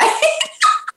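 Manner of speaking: high-pitched burst of laughter; a bright laugh tossed up high. Real, not acted. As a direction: mom laughing about the just-in-case text. Laughing at own joke.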